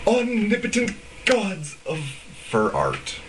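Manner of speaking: slow, grandly reverent mock praise; mock reverence. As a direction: lay the awe on thick, mocking. Mocking the idolized artists.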